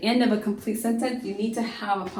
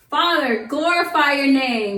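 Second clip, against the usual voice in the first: louder, slow cry to the Father; shouted prayer; 5 semitones higher, 6 dB louder than usual. Retold or imitated. Jesus shouting to the Father at his death, loud. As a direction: shout the prayer out, drawn long. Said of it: "he just shouts it out".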